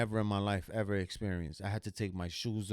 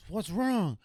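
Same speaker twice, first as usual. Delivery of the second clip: much higher, startled wife asking what's wrong; alarmed wife; 10 semitones higher, 4 dB louder than usual. Retold or imitated. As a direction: voice the wife's startled high question. Wife sees him pale.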